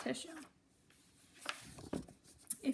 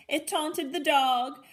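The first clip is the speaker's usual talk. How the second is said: louder, bouncy read-aloud with animal noises; lively storybook reading; 3 semitones higher, 6 dB louder than usual. A narrator's reading. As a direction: read the chaos big for the kids. Animals startled, read with energy.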